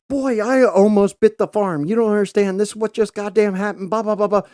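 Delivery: high loud frantic insistence; panicked whine. Retold or imitated. Father insisting to son he almost died, high and frantic. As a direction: squeal it out way up high.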